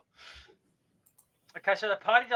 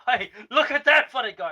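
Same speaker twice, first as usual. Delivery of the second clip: laughing; 5 semitones higher, 9 dB louder than usual. Acted. Pointing out orc, laughing.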